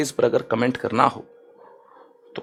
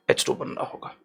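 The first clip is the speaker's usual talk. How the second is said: hushed aside to students; whispered; 10 dB quieter than usual. Shown as a demonstration. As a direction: whisper the formula softly to the class. Whispered repeat of the formula.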